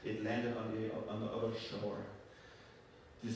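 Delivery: ordinary delivery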